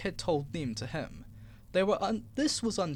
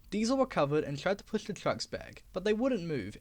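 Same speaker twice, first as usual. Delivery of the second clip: ordinary delivery, nothing marked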